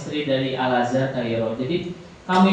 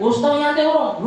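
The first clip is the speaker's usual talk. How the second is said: a high, light angel's question; angel asking; 10 semitones higher than usual. Pretend. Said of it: "tanya malaikat".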